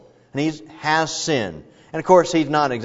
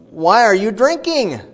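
Eli scolding; 7 semitones higher, 5 dB louder than usual. Retold or imitated. Priest Eli scolding the praying woman, loud rebuke. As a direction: scold her like she's drunk. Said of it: "and he says".

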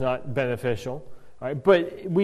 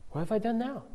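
softer, gentle and non-inflammatory; calm the angry brother down gently; gentle answer; 5 semitones higher, 10 dB quieter, faster than usual. Retold or imitated. David gently answers his angry brother Eliab, softly calm. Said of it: "his answer is non-inflammatory".

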